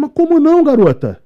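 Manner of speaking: retold shock, high and loud; shocked disbelief. Guide to teen girl, high loud shocked disbelief.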